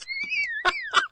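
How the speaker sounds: a breathy high giggle; high quiet giggle. Real, not acted. Laughing at Michelle's jab, soft high giggle.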